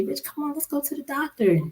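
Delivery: high, soft retold plea; imitating a wife's coaxing; pleading nag. Retold nagging of stubborn husband.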